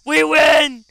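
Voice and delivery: very loud, high, drawn-out cheer; throat-straining victory shout. Real, not acted. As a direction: scream the win at full force. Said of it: "That hurt my throat".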